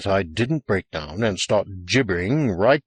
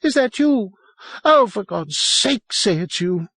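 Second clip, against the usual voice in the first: piercing, high-pitched frantic plea; high, penetrating near-hysterical cry; nearly unhinged; 11 semitones higher than usual. A narrator's reading. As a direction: plead wildly, voice pitched right up. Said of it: "very nearly unhinged".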